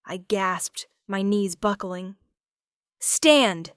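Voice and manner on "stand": higher, louder sharp order; guard ordering the collapsing woman; hissed command. A narrator's reading. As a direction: snap the order. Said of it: "a guard hissed".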